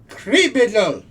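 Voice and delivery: loud, high bark of accusation; brand him a criminal; accusing shout. Acted. Officer accuses the hero.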